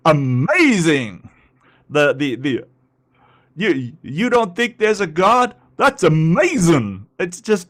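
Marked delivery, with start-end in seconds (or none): 0.1-1.3 slow, loud, drawn-out mock amazement; Jesse Lee Peterson impression. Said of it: "Jesse Lee Peterson". 3.6-7.1 higher, louder mocking preacher voice; Jesse Lee Peterson impression. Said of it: "Jesse Lee Peterson".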